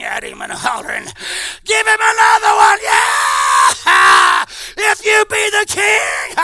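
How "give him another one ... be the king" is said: jeering crowd. Acted. Preacher voicing the crowd cheering the guards on, loud jeering shout.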